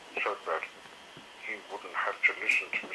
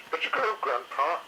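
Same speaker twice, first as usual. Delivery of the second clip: higher, louder rallying call; young dinosaur cheering; excited cheer; 8 semitones higher, 4 dB louder than usual. A narrator's reading. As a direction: urge Grandpa on. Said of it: "shouted Moss Chops".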